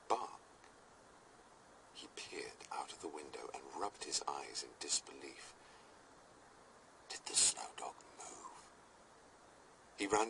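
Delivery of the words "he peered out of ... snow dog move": low hushed whisper. A narrator's reading. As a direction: whisper it in disbelief. Midnight wonder at the window.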